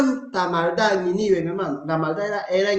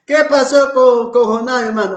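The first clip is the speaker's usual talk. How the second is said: rhetorical call; 4 semitones higher, 6 dB louder than usual. Real, not acted. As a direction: challenge the listeners loudly. Pregunta retórica enfática. (pregunta retórica a los hermanos, en voz alta)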